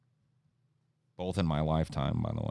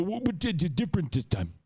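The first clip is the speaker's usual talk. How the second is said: mock squeaky stammer; 13 semitones higher than usual. Believer stammers again.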